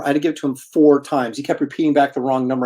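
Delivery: ordinary delivery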